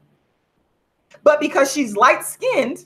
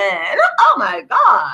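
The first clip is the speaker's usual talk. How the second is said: put-on drawn-out high Valley girl gasp; 4 semitones higher, much slower than usual. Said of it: "a Valley girl".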